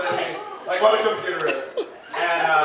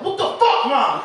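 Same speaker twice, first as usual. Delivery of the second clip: shocked yell at mom; 6 semitones higher, 3 dB louder, slower than usual. Retold or imitated. Yelling at mom over knife.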